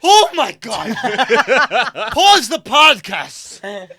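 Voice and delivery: loud, shocked Oh my God with a laugh; laughing, stunned, stop the podcast; shocked outburst. Real, not acted. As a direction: burst out in mock outrage. Shocked reaction to joke.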